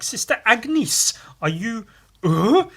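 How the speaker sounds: high, loud, drawn-out dramatic call; acted cult member. Cultist calling on Sister Agnes, theatrical and high. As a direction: address her with mock grandeur. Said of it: "And then this guy's like".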